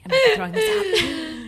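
high, loud tearful laughing; starstruck laugh. Retold or imitated. Vowing to keep Caesar's water, high laughing gush. Retold starstruck over gift.

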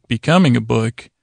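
ordinary talk

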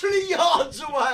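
loud, high-pitched disbelief; incredulous shout. Real, not acted. Podcaster amazed by an obvious lie, shouting. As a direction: yell it in comic exasperation.

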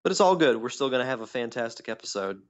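ordinary talk, nothing marked

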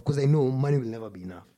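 normal talking voice

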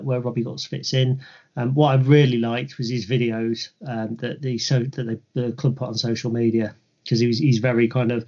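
ordinary delivery

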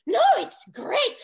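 high, loud, drawn-out enthusiasm; manager's delighted reassurance. Retold or imitated. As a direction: gush as a manager thrilled by a scandal. Voicing the delighted manager's reply.

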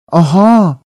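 a sharp, high, loud aha; the penny drops all at once; sudden realization. A narrator's reading. Hermione realizing the answer, a loud high aha.

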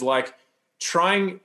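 ordinary talk

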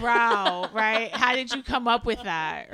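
loud sarcastic marveling; mock amazement. Co-host teasing about the writer's idea, loud mock amazement. Mocking the obvious writing idea.

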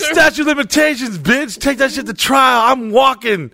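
strut out of court; mock courtroom gloat.

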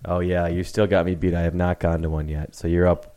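ordinary delivery

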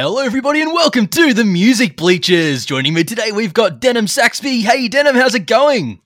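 loud, high, bright-on work greeting; big cheery host voice; customer service voice. Shown as a demonstration. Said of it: "that's my work voice".

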